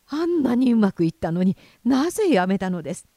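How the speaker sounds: fast, loud, flustered reproach; agitated. A narrator's reading. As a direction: press her in a panic. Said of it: "取り乱した様子でなじった". (取り乱して早口で責める)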